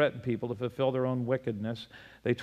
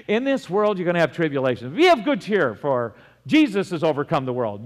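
loud scripture quote; 4 semitones higher, 7 dB louder than usual. Retold or imitated. Quoting Jesus to convert.

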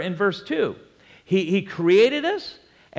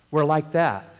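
usual speaking voice

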